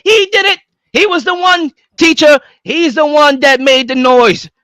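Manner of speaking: high, loud whiny snitch voice; a kid tattling in class, high whiny telling-on voice; tattling student. Retold or imitated. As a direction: point the finger and tell on him to teacher.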